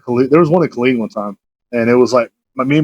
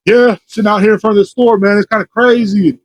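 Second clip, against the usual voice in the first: higher-pitched, imitated loud street talk; talking loudly; 7 semitones higher than usual. Imitating a man outside the store talking to nobody, loudly. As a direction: act out someone loudly talking on a phone. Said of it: "talking really loud".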